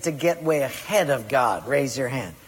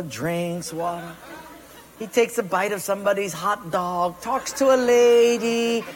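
ordinary talk, nothing marked

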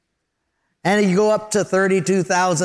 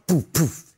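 engine sputter. Retold or imitated. Imitating second engine failing.

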